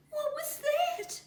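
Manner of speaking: very high, slow scared wail; frightened wail. A narrator's reading. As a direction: wail it out in fear. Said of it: "wailed the little one".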